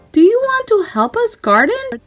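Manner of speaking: high friendly invitation; high Daisy voice. A narrator's reading. Daisy asking Mickey to help garden, high and friendly. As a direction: invite him sweetly.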